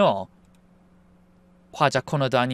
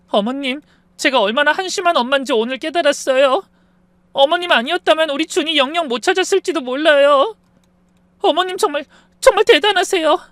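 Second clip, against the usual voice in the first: high, louder tearful confession, a touch of sobbing; crying; 11 semitones higher, 5 dB louder than usual. Acted. Daughter-in-law weeping to her mother-in-law, high tearful voice. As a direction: pour out regret through tears. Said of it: "엉엉 울면서".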